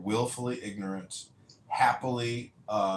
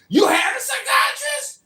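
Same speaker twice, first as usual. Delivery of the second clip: mock shriek; 11 dB louder than usual. Receptionist shrieks.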